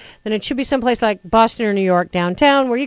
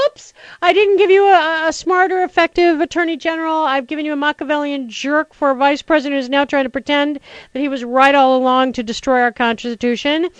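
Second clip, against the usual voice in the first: mocking Bush; 5 semitones higher than usual. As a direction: play a fumbling president botching a pardon. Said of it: "And he's like".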